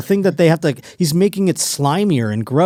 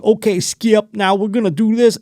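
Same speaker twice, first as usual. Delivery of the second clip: raised-pitch mock talk-show host voice; Shannon Sharpe impression; 8 semitones higher than usual. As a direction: mimic his talk-show cadence. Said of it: "But this is how he talks".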